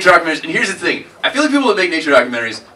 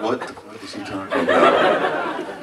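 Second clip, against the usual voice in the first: confused audience member; 6 semitones lower, 5 dB quieter, slower than usual. Retold or imitated. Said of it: "Everybody else will be like".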